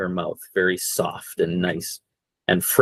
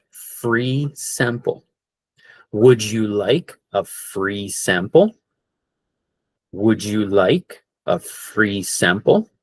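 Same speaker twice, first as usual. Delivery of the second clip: slow model phrase; slower than usual. Shown as a demonstration. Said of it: "this is what you say".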